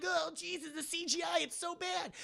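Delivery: high-pitched comic dismay; mock wail. Reacting to bad CGI, high mock wail. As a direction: whine it high, in mock horror.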